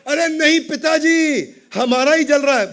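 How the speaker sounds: high, loud protest; acted cry of alarm; alarmed second son. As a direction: urgently contradict father, voice way up. Second son corrects relaxed father.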